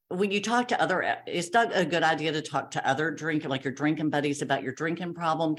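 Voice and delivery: usual speaking voice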